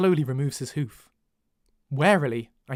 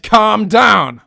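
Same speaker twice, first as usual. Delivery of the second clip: loud, emphatic repeated command; raised-voice demand; forceful order; 4 semitones higher, 9 dB louder than usual. A narrator's reading. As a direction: hammer home the order. Growling captor repeats his order.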